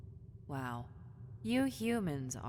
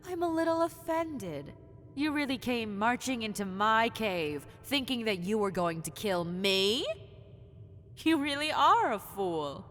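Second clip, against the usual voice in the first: offended, loud scorn; 3 semitones higher, 7 dB louder than usual. Acted. Offended, raising voice at hunter.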